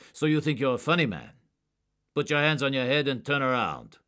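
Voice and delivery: high, loud, hard command; angry order. A narrator's reading. Agent angrily ordering a mocking suspect, loud. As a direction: snap him into line.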